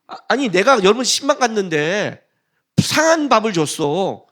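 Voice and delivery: ordinary talk, nothing marked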